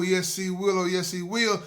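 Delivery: normal talking voice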